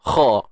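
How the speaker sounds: Kha said deep and heavy; correct thick kha. Shown as a demonstration. Teacher contrasting the correct kha after the wrong one. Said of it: "Sebut macam tu".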